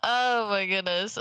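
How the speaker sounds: ordinary delivery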